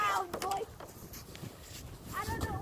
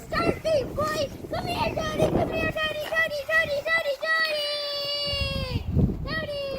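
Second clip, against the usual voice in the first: calling the dog; 3 semitones higher, 5 dB louder than usual. Real, not acted. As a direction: shout for the dog to come.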